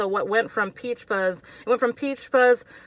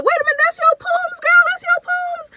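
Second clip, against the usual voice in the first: very high, fast sassy squeal; mocking audience voice; 7 dB louder than usual. Viewers teasing her for being too passive, very high sassy squeal. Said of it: "I know y'all are like".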